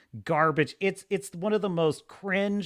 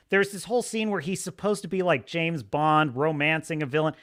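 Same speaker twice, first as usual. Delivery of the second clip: ordinary talk, nothing marked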